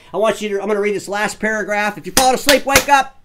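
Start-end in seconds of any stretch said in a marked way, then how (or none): 2.0-3.2 loud, raised wake-up call; higher and punchy, calling out. Real, not acted. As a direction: jolt the dozing listeners awake. Rousing a dozing audience before reading.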